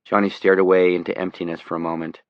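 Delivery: ordinary delivery, nothing marked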